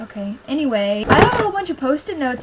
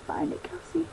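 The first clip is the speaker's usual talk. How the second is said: whispered to herself; 3 semitones higher, 12 dB quieter than usual. Real, not acted. Talking to herself searching book.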